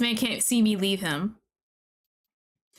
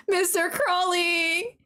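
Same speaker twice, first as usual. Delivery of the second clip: pleading call; 8 semitones higher, slower than usual. Real, not acted. Calling out to lost character.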